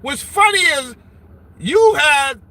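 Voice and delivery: usual speaking voice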